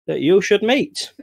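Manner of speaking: high, eager call to Farmer Jenkins; mayor voice. Acted. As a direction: pitch it way up like an eager small-town mayor. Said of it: "And the mayor says".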